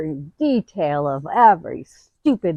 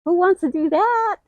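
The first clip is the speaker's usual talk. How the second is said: higher and louder, grumbling at the chore; reluctant whine; 11 semitones higher, 6 dB louder than usual. Pretend. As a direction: groan like someone who won't do homework. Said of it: "Now, now what you're thinking".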